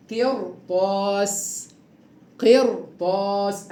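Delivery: قرطاس said slowly, ra thick before ط; heavy ra. Shown as a demonstration. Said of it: "أنا عندي الراء ساكنة أهي".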